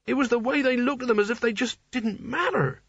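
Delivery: high, far-from-narrator character voice; shocked naive Nigel. A narrator's reading. Nigel dwells on killings.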